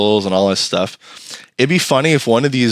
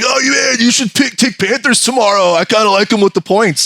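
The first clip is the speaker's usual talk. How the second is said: high, pushy tip; eager chatter; 10 semitones higher than usual. Retold or imitated. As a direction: sell the bet to him. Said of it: "people are like".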